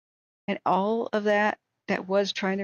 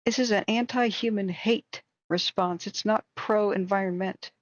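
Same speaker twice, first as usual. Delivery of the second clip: ordinary delivery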